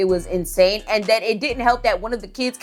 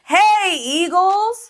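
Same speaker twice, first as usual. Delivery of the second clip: much higher and louder greeting, rising on Eagles; calling out; 11 semitones higher, 4 dB louder, much slower than usual. Retold or imitated. The man greeting the class who ignore him, loud high call. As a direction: call cheerily across the room.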